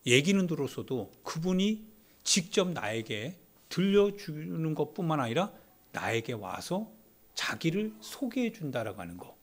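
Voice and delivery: normal talking voice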